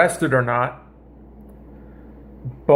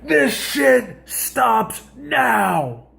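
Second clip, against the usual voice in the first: retold yell; 6 semitones higher, much slower than usual.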